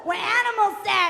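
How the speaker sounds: high, loud mock whoop and holler; mother's excited whoop. Mother thrilled on the raft, hammed-up excited shouting. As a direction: imitate an overexcited Boston mother whooping. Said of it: "she's, she's like, (thick accent)".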